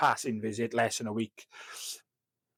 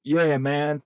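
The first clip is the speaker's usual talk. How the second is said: a quick, lightly put-on American "yeah, man"; sheepish American accent attempt; bad American accent; 3 semitones higher, 4 dB louder than usual. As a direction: try an American accent and half-fail. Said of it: "I can't do an American accent".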